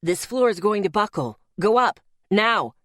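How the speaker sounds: commanding bark, slowing to punched orders. A narrator's reading. Unseen man ordering them upstairs, loud commanding bark. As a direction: bark a warning, then hit the order hard. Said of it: "the voice barked".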